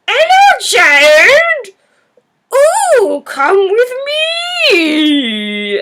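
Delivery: loud mock wail, swooping from high down; caricatured film character. Man finds the child in the hole, hammed-up high wailing call. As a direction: ham it up, high and loud, stretching the last word. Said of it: "and he's like".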